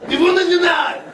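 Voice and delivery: hammed-up old demagogue; Le Pen impression. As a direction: repeat it with puffed-up pride. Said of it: "Le Pen".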